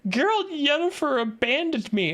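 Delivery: high-pitched mock lament; mock crying. Streamer mimics Ciri crying in the desert, mock-tearful. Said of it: "she starts crying and she says".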